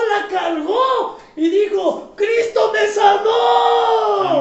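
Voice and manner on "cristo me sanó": high, loud and very slow, almost sung; drawn-out joyful shout. Retold or imitated. Healed woman hugging the doctor, slow sung shout. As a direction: stretch the praise out like a song.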